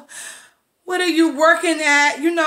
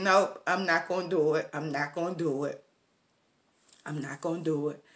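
quiet self-talk; 11 semitones lower, 13 dB quieter, faster than usual. Retold or imitated. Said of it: "felt myself about to tear up".

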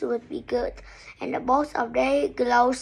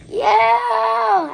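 high, loud drawn-out wail; long shouted yowl; pained howl; 10 semitones higher, 9 dB louder than usual. A narrator's reading. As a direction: howl like a hurt child. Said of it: "howled Peter".

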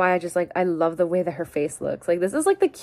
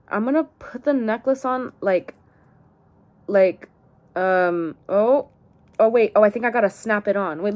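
ordinary talk, nothing marked

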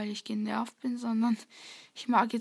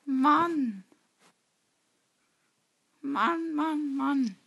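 frustrated groan; 4 semitones higher, 4 dB louder, much slower than usual. Real, not acted. Frustrated after dying.

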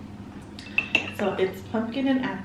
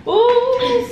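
excited ooh; 13 semitones higher, 7 dB louder than usual. Real, not acted. Excited to see next snack.

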